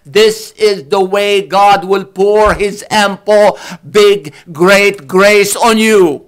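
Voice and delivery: slow, rising to high and loud; building preaching. Acted. Preacher urging the congregation, building to a loud slow peak.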